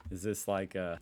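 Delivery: ordinary talk, nothing marked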